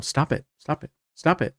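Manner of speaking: mildly exasperated parent, pitch climbing with each repeat. Acted. Parent telling bratty kids off, mildly exasperated. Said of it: "people that have bratty kids".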